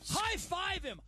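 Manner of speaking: high-pitched rowdy cheer; mock fan yell. Imitating a fan urging high fives, mock yell. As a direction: call it out to the whole section.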